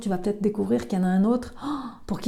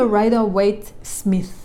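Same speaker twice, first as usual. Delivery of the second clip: slow, self-mocking English accent on Rider-Waite-Smith; playful English accent on the tarot deck's name; much slower than usual. Shown as a demonstration. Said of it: "avec mon super accent".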